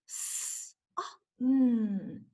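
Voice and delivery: "s", "oh", "mm" said as separate stretched phonemes, "s" hissed voiceless; segmented sounds. Shown as a demonstration. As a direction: sound out each phoneme apart. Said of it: "Can you blend it?".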